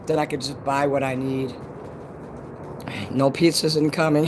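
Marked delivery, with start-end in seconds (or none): none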